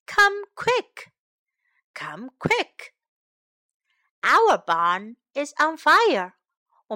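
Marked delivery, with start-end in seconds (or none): 0.1-1.1 high, urgent call for help. Shown as a demonstration. Teacher modelling an urgent cry for help. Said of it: "teacher models a cry for help".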